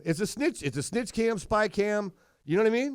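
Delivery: getting riled up about snitch cams; excited. Real, not acted. Worked up about spy cams.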